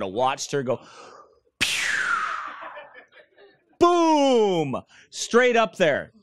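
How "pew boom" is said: rocket sound effect. Retold or imitated. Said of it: "rocket launch".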